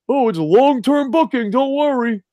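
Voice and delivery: high-pitched mimicry of excuse-making fans; sarcastic, singsong-high imitation; mocking reassurance.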